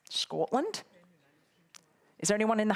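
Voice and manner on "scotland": bad Scottish accent. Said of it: "Was that a very bad, poor, rubbish accent?".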